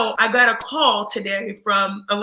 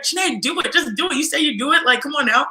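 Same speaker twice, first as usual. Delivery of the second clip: higher, insistent urging; pushy teammate; 5 semitones higher than usual. Retold or imitated. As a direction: egg her on like a pushy friend. Teammate pushing her to write.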